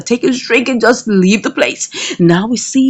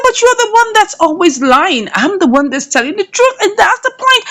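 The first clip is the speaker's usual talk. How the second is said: indignant retort; 8 semitones higher than usual. Retold or imitated. Mother retorts to accuser.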